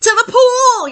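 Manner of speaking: high, loud yell. Acted. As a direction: shout the answer to the boy. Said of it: "yelled the fireman".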